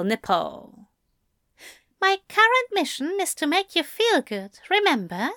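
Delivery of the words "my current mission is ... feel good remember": sweetly teasing gentleman; gentle angel voice. A narrator's reading. Aziraphale voiced higher.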